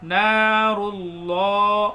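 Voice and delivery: نار اللہ with the ra run straight into the lam, very slow; slow chanted نار اللہ, ra flowing into lam; نار اللہ drawn out, ra merged with lam; ra joined to lam. Shown as a demonstration. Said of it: "اس ہا کو لام میں ملائیں گے".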